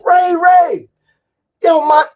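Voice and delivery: very high, full-volume calling out; imitated scream. Janae screaming from the escalator behind him, loud and high. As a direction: holler a name across the street, mimicking her. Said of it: "she screams".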